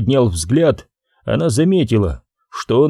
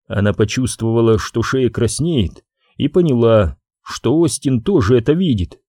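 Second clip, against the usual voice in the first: plain reading voice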